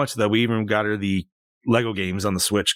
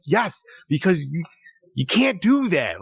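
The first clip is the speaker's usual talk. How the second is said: slow, higher, exasperated; 5 semitones higher, slower than usual. Retold or imitated. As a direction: spell it out to a stubborn kid. Retold scolding of daughter.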